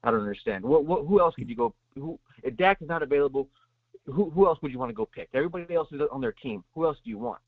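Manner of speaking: ordinary talk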